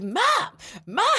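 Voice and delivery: high mock call, no real volume; calling for mom. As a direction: imitate a kid calling out for mom. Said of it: "I had been calling your name".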